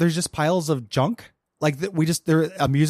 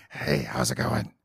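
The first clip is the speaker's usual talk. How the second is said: mock smooth ghoul voice; 5 semitones lower, 8 dB quieter than usual. Voicing ghoul Hancock.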